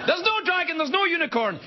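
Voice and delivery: spring the trap on the liar; gotcha. Acted. Author catching the liar.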